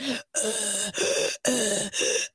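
mock collapsed-lung gasp; imitated mask breathing gasp. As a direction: heave a creepy killer's breath. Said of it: "bad Michael Myers mask breathing".

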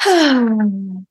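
long relaxing audible sigh; demo sigh. Shown as a demonstration. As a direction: sigh out all the tension. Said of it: "I like to make a sighing sound".

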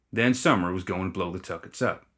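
ordinary delivery

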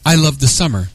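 ordinary delivery

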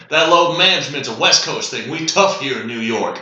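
put-on Staten Island plumber in a loud New York accent. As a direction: do a hammed-up New York working guy. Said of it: "I can't do a New York accent".

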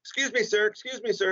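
high, calling out excuse me sir; woman's voice calling. Retold or imitated. Comedian imitating a saleswoman calling after him in a store. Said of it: "I kept going".

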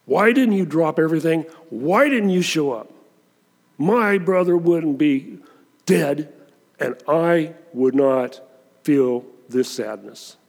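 accusatory. Acted. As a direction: press him with blame, then let grief slow it. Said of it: "it was probably accusatory".

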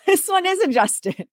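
laughing through the words. Real, not acted. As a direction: laugh it out at yourself. Laughing at her mistake.

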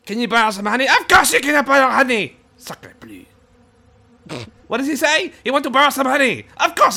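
high, loud mock-French bee; scoffing Monty Python Frenchman; French taunter accent.

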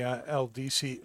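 ordinary talk

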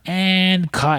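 imitated director's call. Film crew ends Rick's scene, imitated director's call.

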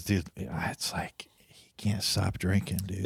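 hushed whisper, slow and breathy-free; whispered gossip. Retold or imitated. Audrey whispering to her friend about his drinking. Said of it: "she goes".

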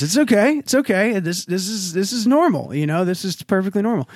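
high, soothing calm-down voice; imitating dad forcing calm; mock soothing dad trying to stay positive; reassuring dad. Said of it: "And he goes".

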